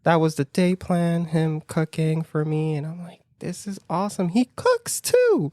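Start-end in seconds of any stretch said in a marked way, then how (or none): 3.4-5.5 giddy delight. Retold or imitated. Date is cooking for me, giddy delight. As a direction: gush in a high, smitten lilt.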